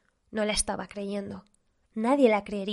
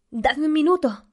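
higher, louder urgent yell; shrieked; 6 semitones higher, 6 dB louder than usual. A narrator's reading. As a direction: yell to be given time. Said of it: "chilló Zala".

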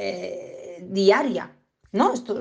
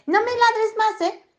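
much higher, sing-song telling-off; mock scolding owner; 12 semitones higher than usual. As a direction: nag the dog in a sweet high voice. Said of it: "en plan".

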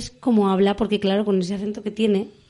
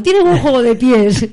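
put-on slower, louder Cantabrian accent on "tiene buen juego de pies"; 3 semitones higher, 7 dB louder, slower than usual. Host repeats the youtuber's line, hamming up the Cantabrian accent. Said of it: "Con ese acento que tiene".

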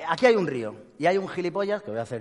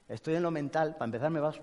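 ordinary delivery

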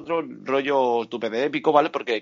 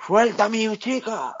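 mock hero threat; 8 semitones higher, slower than usual. Voicing the hero's threat.

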